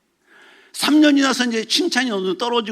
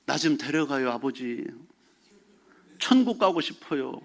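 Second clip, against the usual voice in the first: soft, weary plea, slowing at the end; a mild imitation of crying to God; softer, slow lament; tearful plea; 7 semitones lower, 7 dB quieter than usual. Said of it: "맨날 울어".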